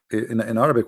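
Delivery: usual speaking voice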